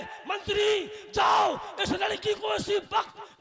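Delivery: loud, high angry order; angry command. Acted. Yamraj shouting at his minister in fury. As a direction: bark the order like an enraged god of death. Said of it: "Yamraj जी को गुस्सा आ गया".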